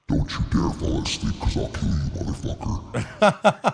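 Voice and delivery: raspy whispered monster threat; menacing monster whisper. Pretend. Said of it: "when I wanna sound like a monster".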